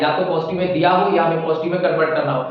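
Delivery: usual speaking voice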